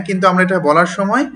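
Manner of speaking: ordinary delivery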